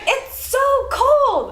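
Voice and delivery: high, slow, drawn-out whine; frustrated mom. Retold or imitated. Mom whining to her daughter about the cold. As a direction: stretch it out like a freezing, fed-up mother. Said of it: "she's so frustrated with me. She's like".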